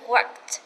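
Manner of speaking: worked, ending t sounded; worked with clear final t. Shown as a demonstration. Teacher demonstrating "worked" ending clearly. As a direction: say "worked" with a crisp t at the end. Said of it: "khi thêm E D các bạn lưu ý cho cô nhá".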